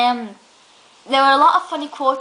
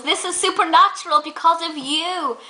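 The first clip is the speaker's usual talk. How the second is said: higher-pitched, hammed-up quote; mock accusation; 6 semitones higher than usual. Retelling Catherine turning round to someone, hammed-up imitation. Acted quote from comedy show.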